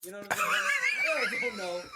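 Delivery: loud, high burst of laughter through words; laughing hard. Real, not acted. Storyteller losing it over his prison scare, laughing loudly. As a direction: crack up while talking. Cracks up at own prison fear.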